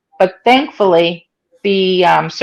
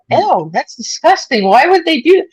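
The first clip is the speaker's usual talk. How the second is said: disgusted; 8 semitones higher than usual. Retold or imitated. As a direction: recoil at the idea. Reacting to doctor's remark.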